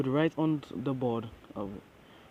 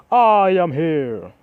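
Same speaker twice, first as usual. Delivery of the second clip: loud, high, drawn-out triumphant shout; booming hero entrance; 5 semitones higher, 12 dB louder, much slower than usual. A narrator's reading. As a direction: announce yourself like a superhero, stretching the words. All Might bursts into the classroom.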